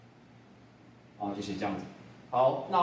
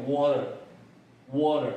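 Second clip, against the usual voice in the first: American "water" with the T tapped like a D; American model of "water" for learners; American accent; much slower than usual. Shown as a demonstration. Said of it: "美式呢会念".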